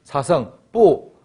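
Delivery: 뿌 said very slowly with a sharp falling fourth tone. Shown as a demonstration. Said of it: "원래 성조가 사성이다". (뿌를 아주 천천히 4성으로 뚝 떨어뜨려)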